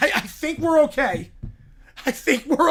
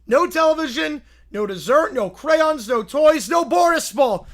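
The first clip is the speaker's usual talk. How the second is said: shouted scolding; 3 semitones higher, 4 dB louder than usual. Acted. Boris listing punishments.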